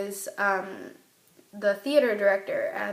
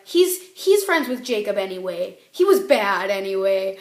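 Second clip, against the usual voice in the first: a louder mock-dismissive brush-off, imitating; dismissive friend; 8 dB louder than usual. Friend Ayla cheering her up about the ex, mock dismissive. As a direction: wave him away loudly as the friend would. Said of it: "and she was like".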